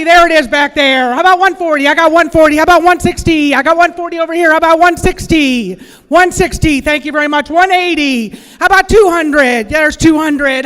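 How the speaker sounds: loud, driving bid calling; auctioneer. Acted. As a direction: call bids like a live auctioneer. Runs a practice auction.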